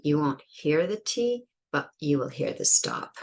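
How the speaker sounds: ordinary talk, nothing marked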